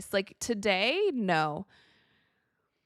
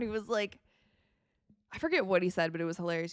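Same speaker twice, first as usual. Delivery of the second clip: ordinary talk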